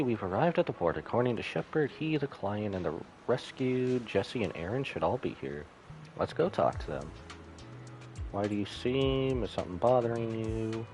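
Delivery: ordinary talk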